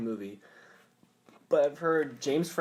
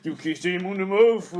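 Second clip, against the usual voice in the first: hammed-up Japanese caricature; mock Japanese voice; 4 semitones higher, 8 dB louder than usual. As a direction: stretch it out like a cartoon Japanese samurai. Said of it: "my racist Japanese voice sounds like".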